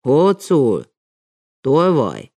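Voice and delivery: loud shout at the dog. A narrator's reading. Guard shouts at the wolfhound, loud call. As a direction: bark the name out loud. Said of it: "kiáltott az őr".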